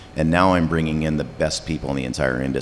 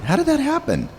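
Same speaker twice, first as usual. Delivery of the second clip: much higher, squeaky disbelief; incredulous. Real, not acted. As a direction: ask it in real amazement. Surprised the panel is over.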